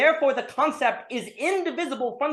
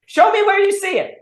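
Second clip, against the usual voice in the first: much higher and louder, almost shouting the challenge; escalate, nearly yelling "Show me"; exasperated demand; 12 semitones higher, 5 dB louder than usual. Retold or imitated. Hegel demanding proof, repeated.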